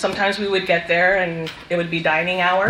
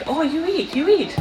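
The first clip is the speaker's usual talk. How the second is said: higher, coaxing little-grandma voice; imitating grandma; 10 semitones higher than usual. Grandma pressing watermelon on her grandkids, higher coaxing voice. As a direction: sweetly insist they eat, grandma style. Grandma urging them to eat.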